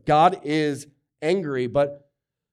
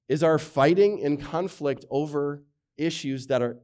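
usual speaking voice

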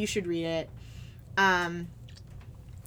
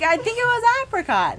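high-pitched, louder singer impression; 7 dB louder than usual. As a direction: exaggerate it as a mocking impersonation. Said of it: "You say it like [name]".

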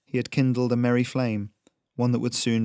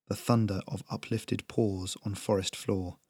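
plain reading voice

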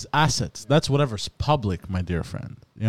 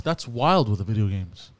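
usual speaking voice

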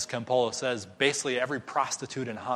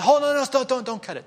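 high, loud panicked plea; high, urgent begging; pleading Agnes; 12 semitones higher, 8 dB louder, faster than usual. Retold or imitated. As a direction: stop them cutting the cake. Agnes begs them not cut.

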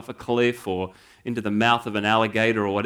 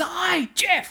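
much higher, friendly put-on voice; cheery David Hurley voice. Governor General greeting the removal guy from his car, high cheery put-on voice. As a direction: pitch way up, cheery and welcoming.

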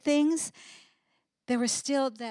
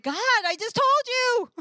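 much higher, louder comic exasperation; comic exasperation, pitched way up; exasperated [name]; 10 semitones higher, 4 dB louder than usual. Acted. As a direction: throw up your hands, I already said this.

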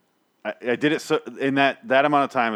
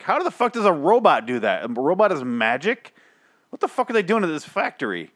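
baffled outburst; 7 semitones higher, 3 dB louder than usual. Retold or imitated. Retells his baffled reaction.

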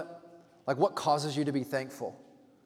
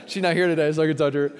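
laughing aloud, louder and higher; 5 semitones higher, 6 dB louder, faster than usual. Real, not acted. Pastor teases his mother while she is absent, laughing aloud. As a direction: tease your mom through laughter.

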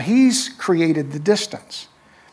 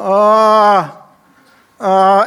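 loud, drawn-out wail; imitated childhood cry; 2 semitones higher, 6 dB louder than usual. A preacher retells crying for mom after a bike fall, imitated wail. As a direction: let out a long, loud wail. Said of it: "And I just went".

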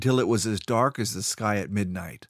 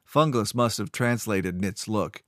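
ordinary delivery

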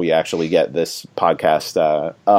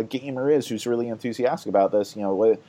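normal talking voice

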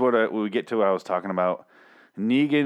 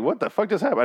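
high-pitched baffled question; incredulous reaction; 9 semitones higher than usual. Retold or imitated. Reviewer recalling the attack that happened so quick, incredulous. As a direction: re-enact your disbelief, pitch leaping up.

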